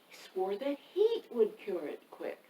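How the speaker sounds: plain reading voice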